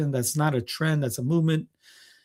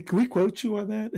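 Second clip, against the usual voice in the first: laughing; 7 semitones higher, 4 dB quieter than usual. Real, not acted. Laughing reply to quote.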